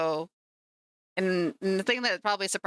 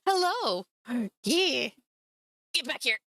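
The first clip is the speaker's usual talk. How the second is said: much higher, sing-song hello; high pet greeting; 4 semitones higher than usual. Real, not acted. Greeting the cat who came back, high and cooing.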